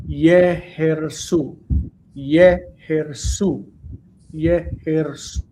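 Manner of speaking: "Yehersu" slow, syllable by syllable, leaning on "Ye"; secondary stress demo. Shown as a demonstration. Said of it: "It's a secondary word stress that sits right there".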